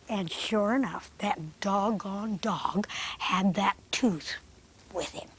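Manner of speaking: soft, quiet storytelling voice; hushed narration. A narrator's reading. Puppeteer narrates the puppet show to kids, hushed. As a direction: tell it softly to the children.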